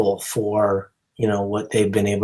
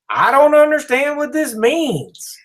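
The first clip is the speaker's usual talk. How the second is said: put-on Southern accent; 3 dB louder than usual. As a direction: do her line in a hammed-up Southern accent. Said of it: "I keep trying to do Southern accents".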